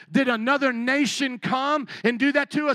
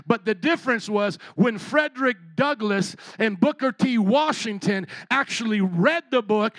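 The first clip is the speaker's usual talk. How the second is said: usual speaking voice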